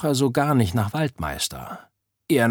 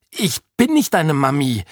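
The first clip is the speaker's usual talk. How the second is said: higher, emphatic denial; indignant protest; 5 semitones higher, 4 dB louder than usual. A narrator's reading. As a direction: push back firmly at an unwanted claim. Oda rejects the slime as child. (empört abwehren)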